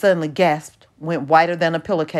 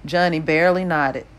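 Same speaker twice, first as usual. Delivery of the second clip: plain reading voice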